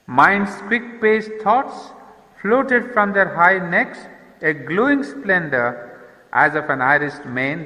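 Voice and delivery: slow, lifted reading of verse; poem recitation. Shown as a demonstration. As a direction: read the line as poetry, savouring each word.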